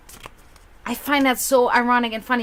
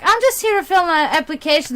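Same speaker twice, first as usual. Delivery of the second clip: retold announcement; 6 semitones higher, 4 dB louder, faster than usual. Acted quote of herself arriving.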